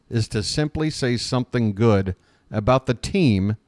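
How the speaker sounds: ordinary delivery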